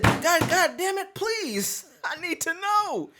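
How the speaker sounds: high, slow hammed-up begging; mock pleading. Before getting in the car, begging the secretary in a high, slow hammed-up voice. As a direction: beg dramatically, drawn out.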